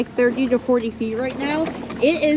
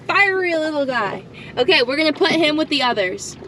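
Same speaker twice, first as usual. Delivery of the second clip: normal talking voice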